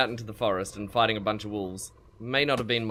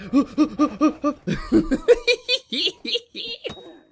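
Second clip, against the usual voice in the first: laughing; 10 dB louder than usual. Real, not acted. Laughing at own failure.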